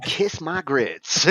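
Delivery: sassy Southern diner waitress, drawn out; high, slow Southern catchphrase; Flo. Retold or imitated. Sitcom waitress at the diner, sassy Southern drawl. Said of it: "Alice at Mel's Diner and Flo was like".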